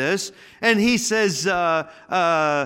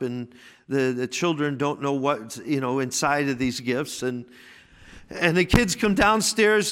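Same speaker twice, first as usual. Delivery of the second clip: normal talking voice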